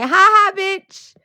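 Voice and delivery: high, loud taunting cackle of a voice; mocking God. God laughing at her, high and taunting. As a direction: sneer it down from on high. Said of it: "He's like".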